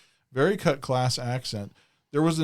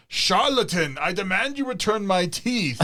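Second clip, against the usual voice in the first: higher, pompous outraged noble; grand mock demand; 6 semitones higher, 4 dB louder than usual. Dazed patient demanding teeth from doctor, mock haughty. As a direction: demand your teeth back like a haughty lord.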